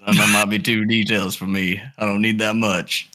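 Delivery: put-on improvised girlfriend's dad with an uncertain country accent; lightly put-on rural accent. Dad questioning his daughter's boyfriend, in a put-on country accent. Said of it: "no idea what this accent is".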